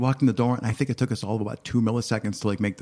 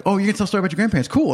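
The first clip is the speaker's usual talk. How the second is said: quick, high, eager voice; fast eager; 10 semitones higher, 4 dB louder, much faster than usual. Retold or imitated. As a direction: rattle it off high and keen. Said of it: "I was like".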